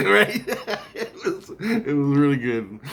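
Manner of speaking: high, laughing delight; words tumbling out through laughter. Real, not acted. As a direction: crack up while you talk. Laughs at own argument impression.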